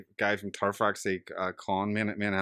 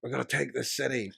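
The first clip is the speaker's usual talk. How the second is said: hammed-up battle declaration, raised pitch; dramatic actor; 5 semitones higher than usual. Famous actor rallying warriors on the beach, dramatic imitation. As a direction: proclaim it like a mock war leader. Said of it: "goes up all dramatic".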